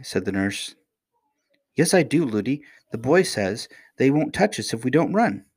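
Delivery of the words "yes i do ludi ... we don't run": put-on high child voice; lightly acted earnest child; little princess, earnest. A narrator's reading. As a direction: reassure the nurse as a little girl would.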